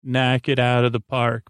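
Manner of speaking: normal talking voice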